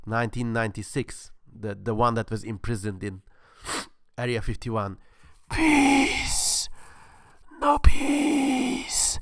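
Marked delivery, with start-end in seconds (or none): 5.5-9.2 high, drawn-out alien voice; otherworldly alien god; alien from Independence Day. Acted. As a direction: intone it like a captive alien. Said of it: "reading this in the voice of the alien in Independence Day".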